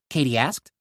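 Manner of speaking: ordinary delivery, nothing marked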